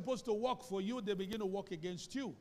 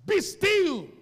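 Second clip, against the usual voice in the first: much higher, much louder command; commanding shout; 11 semitones higher, 15 dB louder than usual. Acted. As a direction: order the storm to stop. Jesus commands the storm.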